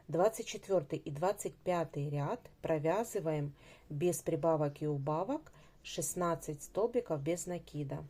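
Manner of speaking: normal talking voice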